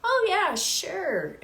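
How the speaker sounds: high, breezy offhand yes; a lightly voiced doctor, very high and carefree; casual doctor. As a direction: toss off an easy agreement.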